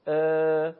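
ا said as a short, light open vowel; clipped light ا demo. Shown as a demonstration. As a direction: give ا as a brief, thin a sound.

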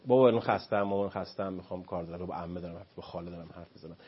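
mock-weary parent brushing the child off; dismissive parent trailing off; tired excuses.

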